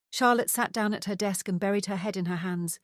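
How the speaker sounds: plain reading voice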